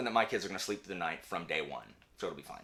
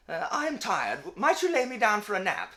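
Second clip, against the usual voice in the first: lightly voiced polite British child, a bit higher; 11 semitones higher, 4 dB louder than usual. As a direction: ask politely like a prim little English child. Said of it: "Why are they British?".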